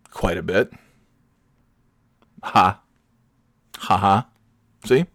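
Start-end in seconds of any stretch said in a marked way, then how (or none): 2.4-4.3 deadpan fake laugh. Shown as a demonstration. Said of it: "I'm laughing right now".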